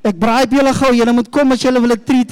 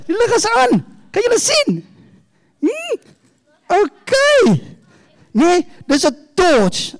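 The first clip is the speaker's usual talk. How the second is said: put-on bright, high storytelling to kids; talking to children; 4 semitones higher than usual. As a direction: coax the little ones like a children's pastor. Talks to children, props.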